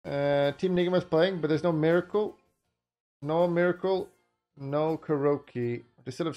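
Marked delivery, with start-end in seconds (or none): none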